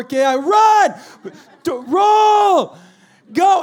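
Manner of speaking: high, loud frantic commands; urgent shouted orders. Acted. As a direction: bellow them out of the car. Acts hurrying kids out of moving car.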